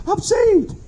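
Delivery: high-pitched overjoyed cry, imitated; mock tearful joy. Preacher mimicking a new convert, mock tearful joy. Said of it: "with tears of joy saying".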